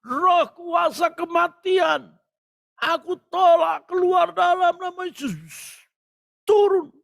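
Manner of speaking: high, shouted deliverance prayer; commanding prayer. Retold or imitated. Preacher rebukes spirit of death over child, high commanding shout. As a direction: cast out death with drawn-out force. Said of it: "saya juga berdoa".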